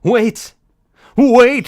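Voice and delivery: loud high call. Acted. Speaker calling after Snake, loud and high. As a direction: shout to stop them.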